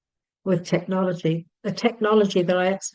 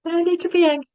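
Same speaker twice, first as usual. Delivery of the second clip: much higher, louder mock squeal of the same phrase; 11 semitones higher, 4 dB louder, much faster than usual. Speaker mocking her own calming self-talk, squeaky high. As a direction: squeak it out as a silly panicky joke.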